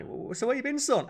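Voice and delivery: high mock parent voice. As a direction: play the nosy parent. Parent asking kid where been.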